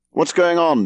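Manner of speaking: high-pitched demand for an answer. A narrator's reading. Brother tumbling onto the landing, demanding an explanation. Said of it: "demanded Leslie".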